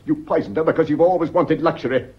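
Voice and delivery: loud, relentless accusation. Acted. Husband accuses wife of poisoning, loud and relentless. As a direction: pin the murder on her.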